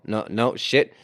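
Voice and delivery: ordinary talk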